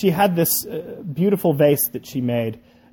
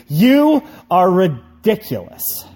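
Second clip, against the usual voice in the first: higher, slow, emphatic outburst; mock scream; 5 semitones higher, 4 dB louder, slower than usual. A narrator's reading. Clay screaming at the potter from the oven, exasperated. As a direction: lean hard on each word, voice raised. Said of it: "I started to scream".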